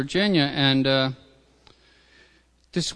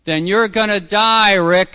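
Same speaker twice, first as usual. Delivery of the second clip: higher, louder, slow warning shout; retold yell; 7 semitones higher, 10 dB louder, slower than usual. Sponsor yells after him in front of everyone, loud slow warning. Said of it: "and he yelled at me".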